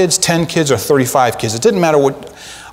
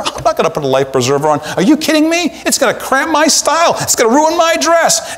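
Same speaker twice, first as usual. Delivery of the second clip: higher, fussy mock refusal of the life preserver; vain passenger; 11 semitones higher than usual. Preacher mimicking a vain Titanic passenger, high and scoffing.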